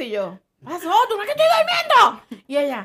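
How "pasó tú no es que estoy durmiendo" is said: higher, louder confused waking voice; mock startled voice. Woken by daughter, higher startled reply. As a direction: jolt awake, baffled.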